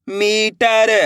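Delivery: मीटर with मी held long; teacher sounding out मीटर, long first syllable. Shown as a demonstration. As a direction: stretch the ee in मीटर. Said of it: "बड़ी ई की मात्रा को खींच के पढ़ते हैं".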